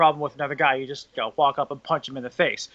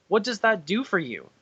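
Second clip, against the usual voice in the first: ordinary talk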